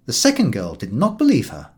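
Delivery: ordinary delivery, nothing marked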